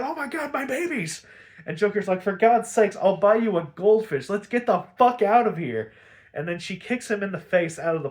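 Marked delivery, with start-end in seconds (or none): 0.0-1.3 very high mock-distressed cry; high-pitched imitated alarm; panicked squeal. Harley suddenly remembers her hyenas. 2.2-5.9 loud, raised, fed-up acted rant; loud mock exasperation; exasperated yelling. Exasperated Joker hurries Harley along.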